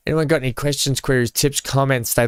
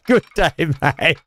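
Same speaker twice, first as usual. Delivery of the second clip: a broad Australian G'day, pitched up and played up; hammed-up Aussie greeting; 6 semitones higher than usual. Greeting Kate in a hammed-up Australian accent. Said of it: "It's your fault we've got this accent".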